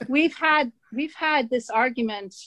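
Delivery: usual speaking voice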